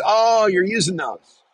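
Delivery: slow, high mock-innocent jab, lightly played; in jest. Old inmate to the narrator, passive aggressive jest. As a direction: drawl it out like a passive-aggressive joke. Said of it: "kinda in jest".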